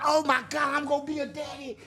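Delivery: high, excited mock delight; hammed-up happy new father; mock joy. Inmate hearing he'll be a dad, high mock excitement. Imitating his naive joy.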